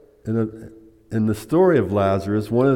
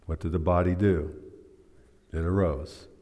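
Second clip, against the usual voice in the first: ordinary delivery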